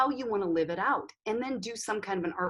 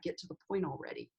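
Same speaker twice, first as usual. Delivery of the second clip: fast, much softer, lower grumble; impatient aside; 7 semitones lower, 10 dB quieter, faster than usual. Retold or imitated. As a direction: mutter it under your breath, fed up. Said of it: "you might be like".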